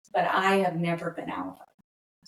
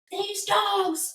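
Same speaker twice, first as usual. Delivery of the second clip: high, exasperated exclamation; exasperated cry; 13 semitones higher than usual. Retold or imitated. Mock outrage at the dogs to her family. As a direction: yelp it out at the naughty dogs. Texting family about the wrecked plant.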